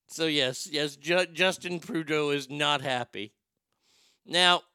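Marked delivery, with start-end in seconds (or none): none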